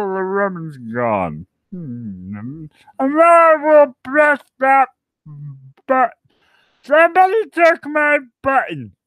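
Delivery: loud high alien menace over a lost button; Marvin the alien. Pretend. Drunk streamer voices Marvin threatening the planet, high and loud. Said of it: "my alien guy, my Marvin".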